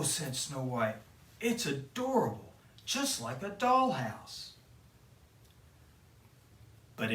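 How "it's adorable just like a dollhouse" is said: put-on higher, sweet girlish delight; delighted princess. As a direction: coo over the little house.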